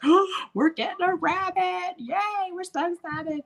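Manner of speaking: put-on high, squealing childlike glee; kids' excitement. Kids at the rabbit farm thrilled, squealing with glee. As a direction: squeal it like a thrilled little kid. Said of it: "and we were like".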